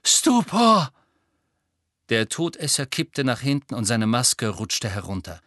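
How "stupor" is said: high breathless spell cry; gasped spell. A narrator's reading. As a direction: gasp it out with no air.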